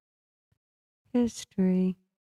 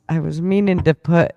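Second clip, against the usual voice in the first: ordinary talk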